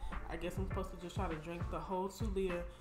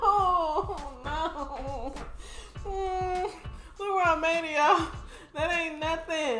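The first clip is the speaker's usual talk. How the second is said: laughing; 9 semitones higher, 8 dB louder, much slower than usual. Real, not acted. Laughing after burp mishap.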